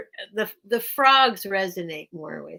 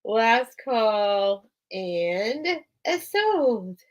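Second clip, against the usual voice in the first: slow drawn-out auction call; auctioneer call; much slower than usual. Real, not acted. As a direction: stretch it like closing a sale. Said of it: "auction call".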